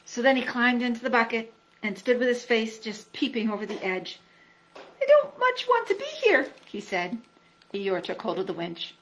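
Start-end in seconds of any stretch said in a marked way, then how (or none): 4.8-6.5 high, small, unwilling voice; a timid pig who wants out; reluctant Piglet. A narrator's reading. Piglet in the bucket telling friends he doesn't want to be there.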